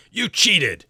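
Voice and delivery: high, loud outburst of a sore loser; angry accusation. Acted. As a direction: hurl the accusation across the range. Todd accuses winner after losing.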